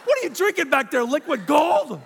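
loud, high outraged disbelief; hammed-up outrage at the bill; indignant shock. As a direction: balk at the price, loud and high. Indignant retort at the price.

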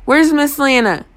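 contained, urgent shout. A narrator's reading. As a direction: call it out, demanding an answer. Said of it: "I shouted".

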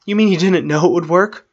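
high, loud outraged question; incredulous yell. A narrator's reading. Arwen challenges Strider on his escape plan, yelling. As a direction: yell it in disbelief. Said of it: "Arwen yelled".

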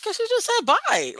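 high, slow pouting whine; mock tearful whine. Explaining to the partner why she cried, high and whiny. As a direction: whimper it like a wounded mom.

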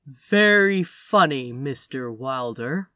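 slow, deliberate, every word spaced out; cold, measured sarcasm to the suspect; slow and clear. Acted. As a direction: spell it out slowly like a warning. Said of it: "very slowly and very clearly".

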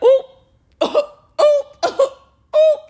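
high, loud squeaked reactions; mock shocked oop. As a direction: imitate a startled crowd in sharp high yelps. Everybody's shocked reaction re-enacted.

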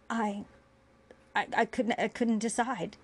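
hesitant, halting reply with restarts; understated faltering stammer. A narrator's reading. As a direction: stumble over the first words, pausing. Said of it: "Bashir stammered in a whisper".

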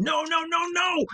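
very high, frantic cries; panicked screaming. Acted. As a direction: cry out in terror. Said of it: "Nikolai screamed".

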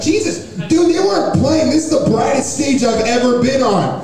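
high, loud exclamation; exasperated amazement. Real, not acted. Comic blasted by the brightest stage lights, high loud exclamation. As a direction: cry out high as if hit by the glare.

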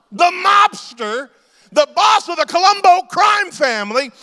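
loud, high, slow proclamation; excited shout. Real, not acted. Preacher telling the congregation he saw Gotti, loud slow shout. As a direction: shout it to the crowd like big news.

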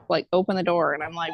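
usual speaking voice

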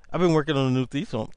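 hurried, fast delivery tumbling out; nervous fast talk; rushed. Real, not acted. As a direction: rattle it off too quickly. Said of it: "I'm gonna slow down".

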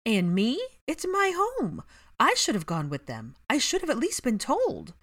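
loud, high indignant protest; angry. Acted. Hermione cutting in angrily about her flat, loud. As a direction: insist it is her home. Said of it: "Hermione cut in angrily".

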